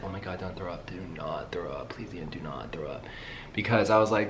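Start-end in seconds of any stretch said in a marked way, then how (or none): 0.0-3.0 tense whisper to herself; whispered plea. Retold or imitated. Begging herself not to throw up on the bumpy flight, whispered.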